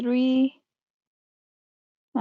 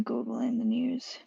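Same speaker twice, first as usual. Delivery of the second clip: whispering; 7 dB quieter than usual. Real, not acted. Reading search results to himself.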